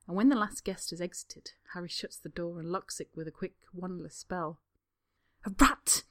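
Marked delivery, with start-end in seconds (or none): 5.4-6.0 louder, exasperated hiss; hisses. Acted. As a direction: spit it out in disbelief. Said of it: "Draco hisses".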